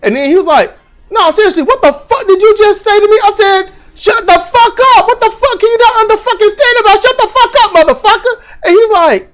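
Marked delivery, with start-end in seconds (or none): 1.1-3.2 high, heated demand; yelling challenge. Retold or imitated. Dude confronting the teller in line, yelling challenge. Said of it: "the dude that was yelling". 3.9-8.3 high-pitched, rapid-fire tirade; furious yelling. Retold or imitated. Teller shouting down the dude in line, furious yelling.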